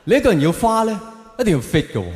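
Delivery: ordinary delivery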